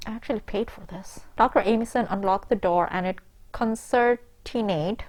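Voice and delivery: ordinary talk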